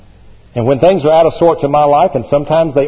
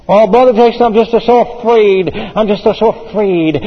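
higher, whiny mock worrier; fearful believer; 6 semitones higher than usual. Preacher mocking a worried believer talking to him, in a whiny voice. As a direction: lightly mimic a timid church member. Mimicking a fearful church member.

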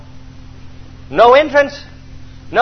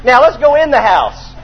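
ordinary talk

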